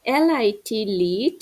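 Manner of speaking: slow spelled letters, then the word; spelling drill. Shown as a demonstration. Teacher spells words for pupils to repeat, slowly. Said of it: "আমি যেরকমভাবে বলতেছি".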